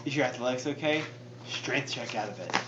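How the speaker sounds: very soft, low undertone; hushed, low conspiratorial aside; trying to whisper. Real, not acted. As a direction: keep it secret from the game master. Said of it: "I'm trying to whisper so Matt can't hear".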